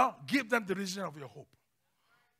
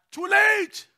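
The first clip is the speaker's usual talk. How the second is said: loud, high-pitched cry; triumphant shout; 11 semitones higher, 8 dB louder than usual. Retold or imitated. Calls out over own protest.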